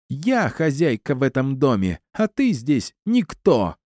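high-pitched, haughty attack, last words drawn out; high, domineering woman's voice; screeching on. A narrator's reading. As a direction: carry on the shrieky tirade. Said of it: "продолжала говорить она".

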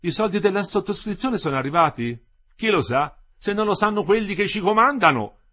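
loud, high and resentful; bitter irony. A narrator's reading. Olindo in the street talks of the strike money, bitterly ironic. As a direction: throw it out with sour irony. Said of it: "V'era dell'astio, dell'ironia nella sua voce".

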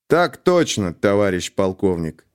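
ordinary delivery, nothing marked

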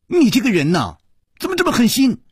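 high-pitched, rising indignation; exasperated, high reproach; scolding reproach. A narrator's reading. Character scolds him as heartless.

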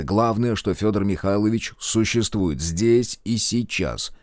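plain reading voice

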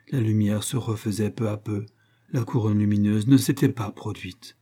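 ordinary delivery

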